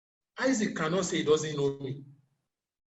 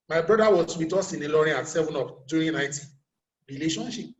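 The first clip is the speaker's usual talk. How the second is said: normal talking voice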